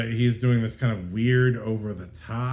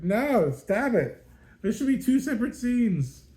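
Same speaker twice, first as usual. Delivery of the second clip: exasperated protest; 12 semitones higher, 4 dB louder than usual. Retold or imitated. Frustrated reacting to forced scene.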